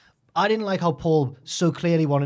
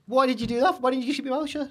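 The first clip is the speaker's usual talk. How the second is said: higher, incredulous scolding; exasperated players; 11 semitones higher than usual. Retold or imitated. Other players scolding the voted-out lad, higher incredulous voice. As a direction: scold him for talking himself out. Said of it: "And they were like".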